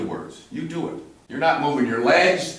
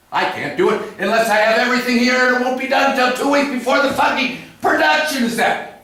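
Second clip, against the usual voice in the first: mock ranting actor; 7 semitones higher, faster than usual. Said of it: "And you said".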